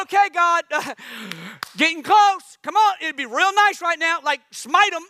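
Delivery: hammed-up nervous plea to God; mock anxious prayer.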